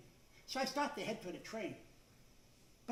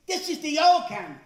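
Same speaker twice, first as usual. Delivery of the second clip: friend's verdict; 6 semitones higher, 14 dB louder than usual. Retold or imitated. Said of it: "My friend takes this".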